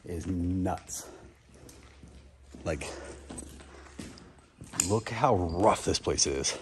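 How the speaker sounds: low, slow sultry murmur; breathy seductive voice; sexy voice. Acted. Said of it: "Why are you using your sexy voice?".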